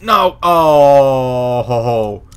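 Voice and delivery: dismayed outburst at the broken lockpick; dismayed yelp. Real, not acted. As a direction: cry out as the pick snaps. Lockpick breaks.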